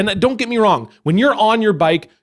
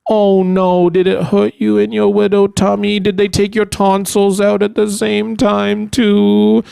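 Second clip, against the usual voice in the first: put-on condescending baby talk; 2 semitones higher, 3 dB louder than usual. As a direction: coo at him like a hurt toddler. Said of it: "People were like".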